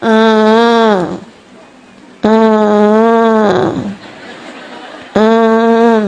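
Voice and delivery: imitated low, droning fake cry; long monotone "hmm" like a kid barely crying; mock whimper, held on one low note; half-hearted child's whine. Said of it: "ada anak nangis gini nih".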